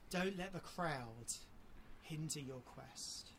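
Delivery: soft, slow, earnest appeal; slow down and plead softly; quiet slow plea. Real, not acted. Preacher begging hearers not to delay, softly and slowly.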